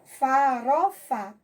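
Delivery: "Farofa" drawn out slowly as fa, ro, fa; syllable by syllable. Shown as a demonstration. Teacher showing pupils three syllables, slowly syllable by syllable. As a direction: spell out "Farofa" slowly, each syllable apart. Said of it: "separar as sílabas".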